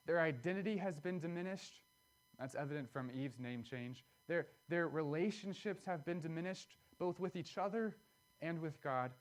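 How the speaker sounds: normal talking voice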